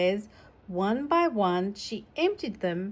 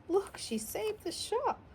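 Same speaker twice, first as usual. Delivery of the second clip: high cooing over a cute picture; high delighted coo at the page; 12 semitones higher, 6 dB quieter than usual. Real, not acted. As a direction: coo it like you've seen a puppy. Coos at picture.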